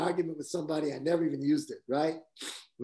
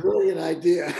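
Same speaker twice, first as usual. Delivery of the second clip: a louder, laughing punchline; 3 semitones higher, 5 dB louder than usual. Real, not acted. Telling friends about a baffling director's idea, laughing. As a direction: laugh the words out as you land the joke. Joking about saying yes anyway.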